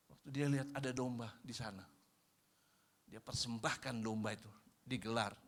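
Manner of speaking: ordinary delivery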